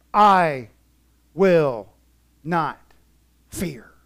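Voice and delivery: each word set apart with pauses, I loud then fading. Acted. Preacher spelling out the vow, word by word. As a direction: space out the words for weight. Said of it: "word by word after pause".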